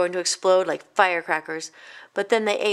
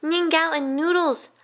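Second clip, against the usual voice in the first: put-on higher, eager child's cry; excited cry; 9 semitones higher than usual. Kong spotting noodles, higher excited cry. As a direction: list the food in glee. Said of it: "cried Kong".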